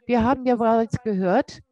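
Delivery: normal talking voice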